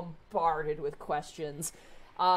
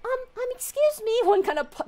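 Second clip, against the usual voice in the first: high, quick little excuse me; a small creature trying to be polite; polite kobold; 8 dB louder, faster than usual. Acted. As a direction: kobold tugging at Vera's leg, high and timid. Kobold tugging at leg.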